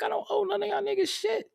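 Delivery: dismissive retort, voiced high; mock retort. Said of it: "And I be like".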